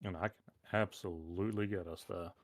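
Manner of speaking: normal talking voice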